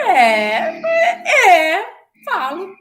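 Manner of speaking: high, loud stammered yes, yes; flustered yes. Retold or imitated. Her flustered reply to the coworker's question. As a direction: caught off guard, stammer it out.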